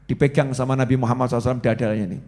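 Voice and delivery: ordinary talk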